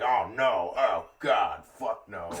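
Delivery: loud, hammed-up dismay; mock horror. Customer offered tongue by server, imitated loud horror. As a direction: cry out in exaggerated disgust.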